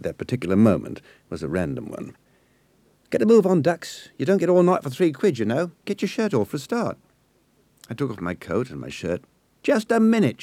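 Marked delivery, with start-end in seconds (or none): 3.1-7.0 much higher, bossy London voice; impatient Cockney girl. A narrator's reading. Nessie hurries the boy to undress, impatient. 9.6-10.4 much higher, loud sudden call; yell. A narrator's reading. Nessie yells at the boy to stop. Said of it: "she yelled".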